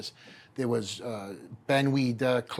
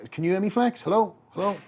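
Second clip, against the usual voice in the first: high, loud calling on a bad line; mock shouting hello into the phone; 9 semitones higher, 6 dB louder than usual. Editor calling on the phone, high loud hello. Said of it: "He's like".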